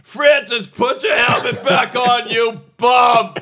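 full-throated heckling yell, high and loud; yelling crowd. Retold or imitated. Fans yelling at a player to put his helmet on, loud high heckling. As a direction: bellow it like a whole section of fans. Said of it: "entire sections yelling".

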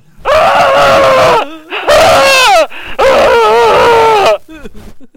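long loud siren wail; siren scream. Pretend. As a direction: wail like an air-raid siren. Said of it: "my imitation".

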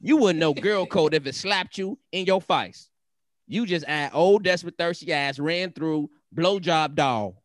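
slow, drawn-out sassy insult; Montana, sassy angry woman. Acted. Reading out the wife's insults, sassy and angry. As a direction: stretch every jab like a mad girl. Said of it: "she all sassy and shit".

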